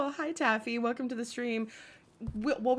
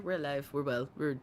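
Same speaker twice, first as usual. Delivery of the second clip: ordinary talk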